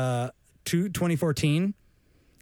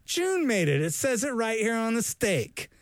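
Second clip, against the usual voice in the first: high, delighted guest voice; impressed dinner guest; 6 semitones higher than usual. Pretend. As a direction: gush over the steak like a dinner guest. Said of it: "So somebody goes".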